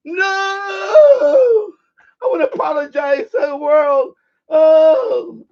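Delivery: loud, drawn-out mock wail; meme imitation. Acting out a well-known meme.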